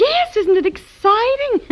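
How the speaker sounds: very high, drawn-out delight; excited gushing. Acted. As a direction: gush along with an excited child. Mother shares child's excitement.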